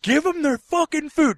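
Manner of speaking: high, drawn-out mock yell; angry demand, re-enacted. Coworker behind the worker, re-enacted angry shout. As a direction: shout the order as if about to swing.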